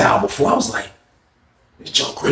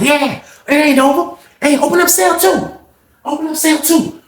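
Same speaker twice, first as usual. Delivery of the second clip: BB hollering to open the cell, pitched way up; high, slow mock call; 12 semitones higher than usual. BB calling up the steps, high slow mock holler. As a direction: stretch it out high like calling a guard.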